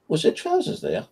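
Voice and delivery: high-pitched puzzled cashier, lightly voiced; cashier's voice. Cashier checking on missed trousers, lightly imitated puzzlement. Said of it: "She goes".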